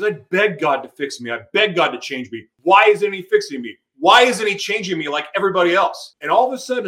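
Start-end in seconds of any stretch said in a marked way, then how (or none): 2.6-6.2 angry plea. Retold or imitated. Retold anger at God.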